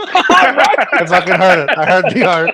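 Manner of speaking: laughing through the words; cracking up mid-sentence. Real, not acted. Laughing fit over vodka.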